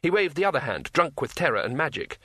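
ordinary delivery

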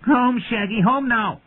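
very high, shrieked command; high-pitched childish yell; small boy's scream. A narrator's reading. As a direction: scream for your pet to come back. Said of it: "remembered himself and screamed".

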